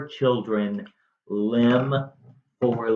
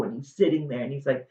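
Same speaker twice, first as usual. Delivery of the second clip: ordinary delivery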